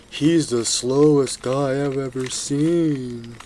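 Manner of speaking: slow, drawn-out mock critic voice; mocking viewer. Imagined viewers mock the slow cuber, drawn-out mocking voice. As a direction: ham up a scornful viewer. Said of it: "people are gonna say".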